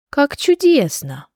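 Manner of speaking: high, slow, uneasy approval; mildly apprehensive, drawn out; wary. A narrator's reading. Rabbit hears of fire-breathing lessons, wary. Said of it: "с опаской сказал кролик".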